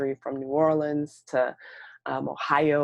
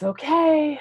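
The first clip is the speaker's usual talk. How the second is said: strained okay; 10 semitones higher, 6 dB louder than usual. Retold or imitated. Stressed front desk worker answers.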